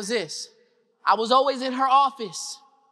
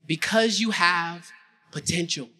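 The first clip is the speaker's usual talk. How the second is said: low, slow and deliberate; calm, earnest reassurance; 5 semitones lower, slower than usual. Acted. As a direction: say it gently, eye to eye. Vice principal answers, eye to eye.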